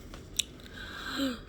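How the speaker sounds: soft shocked intake of breath; sharp gasp. A narrator's reading. Olivia peeking out and spotting danger, frightened gasp. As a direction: gasp in alarm.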